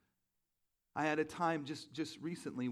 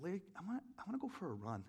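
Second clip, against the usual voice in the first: sheepish request; 4 semitones higher, 7 dB quieter, faster than usual. Retold or imitated. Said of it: "I was almost, like, ashamed".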